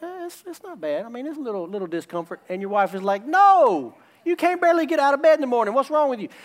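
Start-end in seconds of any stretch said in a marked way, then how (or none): 0.0-2.4 higher, softer evasive mumble, imitated; mock sheepish brush-off; dismissive downplaying. Husband downplaying pain to doctor. 3.3-6.3 high, loud mock outburst; imitated indignant scolding, fast and high; exasperated wife. Exasperated wife contradicts husband at doctor.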